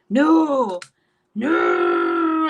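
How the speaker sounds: loud, high drawn-out mock wail. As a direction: stretch it into a hammed-up bawl. Re-enacting crying over another boy.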